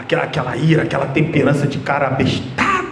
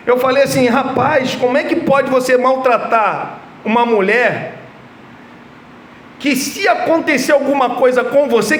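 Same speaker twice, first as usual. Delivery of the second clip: raised, urgent pulpit voice, driving each point home; fervent preaching; 9 semitones higher than usual. Acted. Pastor exhorting the congregation about marriage, fervent preaching. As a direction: preach it hard to the church.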